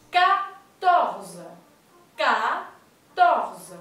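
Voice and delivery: "quatorze" said loud with a K sound and an open O. Shown as a demonstration. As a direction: start "quatorze" on a hard K, open the O. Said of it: "la Q y la U se pronuncia como una K".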